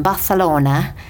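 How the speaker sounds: Barcelona with a lisped Spanish th; Spanish accent. Pretend. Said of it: "do you like my Spanish accent there?".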